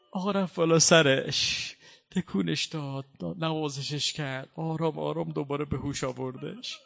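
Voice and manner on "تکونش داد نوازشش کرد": usual speaking voice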